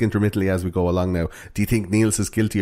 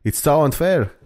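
put-on higher-pitched Finnish accent complaint; 3 semitones higher than usual. Host as aggrieved Finnish teen, mock Finnish accent. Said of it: "That's a pretty good Finnish accent".

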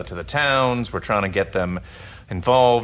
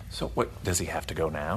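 whisper; 4 semitones lower, 9 dB quieter than usual. Acted. Father answers the child.

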